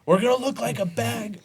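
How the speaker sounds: high, loud, laughing through it; boss's reply told through laughter; laughing quote. Retold or imitated. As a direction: crack up while quoting the boss. Boss quote after whispered aside.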